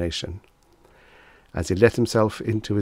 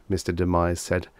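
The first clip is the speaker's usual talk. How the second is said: ordinary delivery, nothing marked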